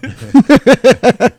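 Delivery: loud, high cackling laugh; big burst of laughter. Real, not acted. A friend cracks up loudly at her own comeback. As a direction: crack up hard.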